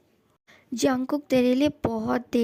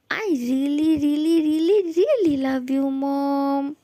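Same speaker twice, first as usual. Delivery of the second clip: louder, drawn-out gushing; 3 dB louder, much slower than usual. Acted. Son clinging to his mother, gushing and louder. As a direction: stretch every really with love.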